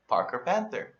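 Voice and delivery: usual speaking voice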